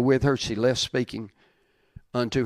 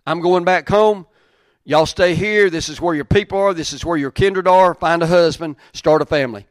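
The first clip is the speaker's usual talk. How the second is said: loud, raised casual Naomi; Naomi paraphrased; 4 semitones higher, 7 dB louder than usual. Pretend. Preacher acting Naomi telling her daughters-in-law to stay, loud and high. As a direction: preach it big as Naomi sending the girls home. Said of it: "She's like".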